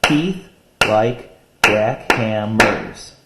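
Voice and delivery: very slow, each syllable spaced out; slow poem reading by syllables; syllable-counting reading. Shown as a demonstration. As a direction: read it out syllable by syllable. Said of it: "Listen to the syllables".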